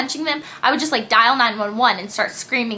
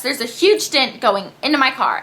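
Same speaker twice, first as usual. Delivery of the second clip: ordinary talk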